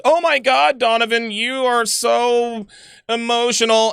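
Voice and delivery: high, mocking whine, so stretched out slowly; mock a viewer who thinks he is too emotional; mocking whiny voice. Streamer mocks viewers calling him emotional, in a high whiny voice.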